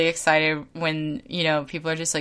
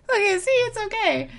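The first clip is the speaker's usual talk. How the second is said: put-on soothing a child. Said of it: "and I'm like".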